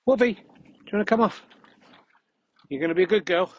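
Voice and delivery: loud high call to a dog. Real, not acted. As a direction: call the dog over excitedly. Calling his dog off lead.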